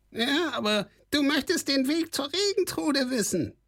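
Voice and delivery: very high, squawking goblin voice; a sly goblin's high squawk; squawky kobold voice. A narrator's reading. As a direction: call out behind him like a squeaky little goblin. Kobold speaks in story.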